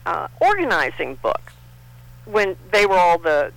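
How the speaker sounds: ordinary talk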